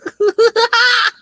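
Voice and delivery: high, loud gloating laugh; villain's gleeful cackle. Acted. The disguised chameleon slipping past the police, with a gloating cackle. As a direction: cackle like you've fooled everyone.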